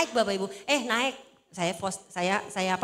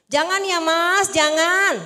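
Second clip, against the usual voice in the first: high, loud, drawn-out catchphrase; hammed-up stretched warning; mimicking viral catchphrase; 4 semitones higher, 9 dB louder, much slower than usual. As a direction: call it out slowly like a viral meme. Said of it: "karena viral langsung ya".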